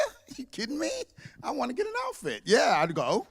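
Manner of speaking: bubbling over at the chance; thrilled. Retold or imitated. Thrilled about going to India.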